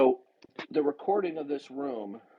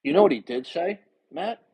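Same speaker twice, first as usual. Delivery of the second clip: loud, raised, angry; loud angry retort; 5 semitones higher, 6 dB louder than usual. Real, not acted. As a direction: raise your voice in anger. Host angry at caller.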